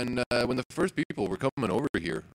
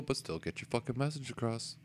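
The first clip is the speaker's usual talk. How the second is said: ordinary delivery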